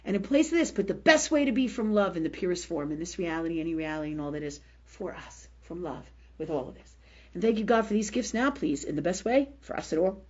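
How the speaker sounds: low, hushed run of prayer; murmured prayer. Shown as a demonstration. Demonstrating her healing prayer to listeners, murmured low. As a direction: pray it softly, dropping low as it goes on.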